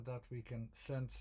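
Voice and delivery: ordinary talk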